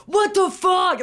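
high, outraged mock shout; hammed-up high yell. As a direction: explode in an instant freak-out. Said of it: "him, like, yelling at her immediately".

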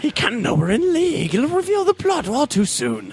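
high, strange villain voice. Acted. Villain warning Penguin the Batman is coming, in a high voice. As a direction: play a high-voiced comic-book villain. Joker role-play dialogue.

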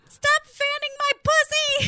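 high, loud, slow shriek; shrieking in labor. Retold or imitated. Retelling Becca snapping at Connie, loud high shriek. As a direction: shriek it out, dragging it. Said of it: "She's like".

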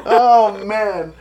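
ordinary talk

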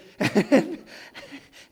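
a burst of real laughter at her own story. Real, not acted. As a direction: laugh out at the memory. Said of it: "speaker laughs at own story".

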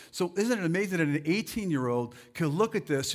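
normal talking voice

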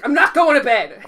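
put-on loud, high, defiant child shout; petulant shout. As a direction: yell it like a sulking kid. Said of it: "He's like".

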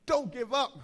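rallying cry. Real, not acted. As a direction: call it out to the whole room. Let them know one more time.

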